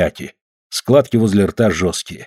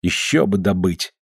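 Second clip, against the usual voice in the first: ordinary delivery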